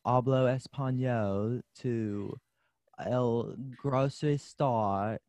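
slow, mock British accent stumbling through Spanish; hammed-up Brit fumbling Spanish words; British person speaking Spanish. Friend mocks a Brit ordering groceries in Spanish, slow British accent. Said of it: "this is a British accent".

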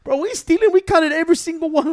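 high, loud mock protest; retold indignant protest. Retold protest to security guard.

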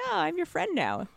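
ordinary talk

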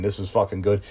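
usual speaking voice

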